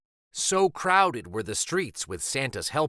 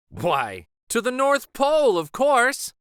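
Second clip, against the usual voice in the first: rising high and loud on North Pole; a conductor proudly announcing the destination; cheerful conductor; 9 semitones higher, 5 dB louder than usual. Acted. Conductor voiced in story.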